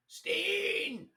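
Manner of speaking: high, drawn-out call of the name; guard hollering a name down the block. Retold or imitated.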